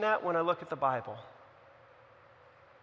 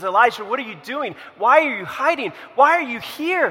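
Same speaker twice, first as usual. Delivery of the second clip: acted confrontation, rising higher and louder; urgent questioning; 5 semitones higher, 10 dB louder than usual. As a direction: press a hiding man with louder and louder questions. God confronts hiding Elijah, acted.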